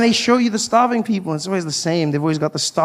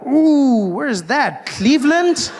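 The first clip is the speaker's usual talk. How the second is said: mock curious surprise; 7 semitones higher, slower than usual. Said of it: "I'm like".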